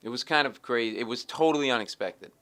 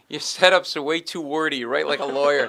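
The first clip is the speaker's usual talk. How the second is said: loud, higher-pitched mock complaint; retold scolding; 5 semitones higher, 10 dB louder than usual. Joke buyer berates lawyer's setups, loud scolding. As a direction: scold the writer for his style.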